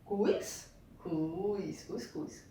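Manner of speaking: Cus, cuscuz said syllable first, then whole; syllable split. Shown as a demonstration. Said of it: "duas sílabas". (cuscuz separado em sílabas)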